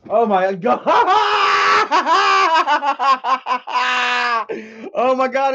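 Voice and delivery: loud, high startled yelp with laughter; jolted by a sudden donation alert; real fright turning into a laugh; startled shout. Real, not acted. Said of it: "That shit scared the shit out of me".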